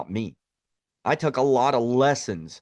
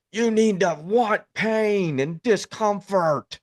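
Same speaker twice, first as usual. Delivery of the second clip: higher, slow mock motivational-guru preaching; slow, higher parody of a pain-loving guru; mock Andy Frisella hype; 8 semitones higher, slower than usual. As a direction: ham up a tough-love hype coach, drawn out. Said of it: "I'm not gonna do the whole Andy Frisella ... Lions Not Sheep thing".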